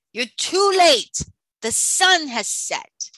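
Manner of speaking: loud, high, slow villain's taunt; a sea witch crowing in triumph, loud and drawn out; gloating sea witch. Acted.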